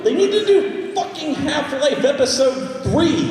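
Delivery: high, loud and slow, laughing rant; a laughing, loud, slow plea; excited rant. Real, not acted. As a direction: demand it with comic outrage. Ranting, laughing.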